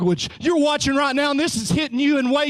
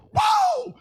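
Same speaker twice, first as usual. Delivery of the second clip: excited whoa. Real, not acted. Preacher excited by the song.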